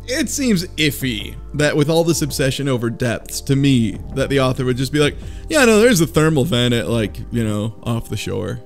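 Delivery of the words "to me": normal talking voice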